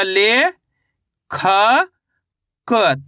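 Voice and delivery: "ले, खा, क" drawn out with long pauses between; teacher spelling out "ले... खा... क" in separate pieces; syllable by syllable. Shown as a demonstration. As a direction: sound out "ले", "खा", "क" one at a time. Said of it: "तो मिलके बन जाएगा लेखक".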